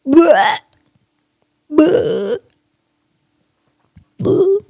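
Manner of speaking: retching bleh sounds, rising in pitch; disgusted bleh. Real, not acted. Vlogger grossed out by cockroach, gagging bleh. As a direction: gag at the thought of the bug. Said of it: "disgust noises at cockroach".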